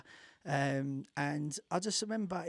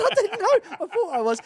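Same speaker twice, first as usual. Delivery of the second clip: much higher and louder, caught out and defensive; flustered protest; 13 dB louder than usual. Retold or imitated. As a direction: squeal it like you've just been found out. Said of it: "I said".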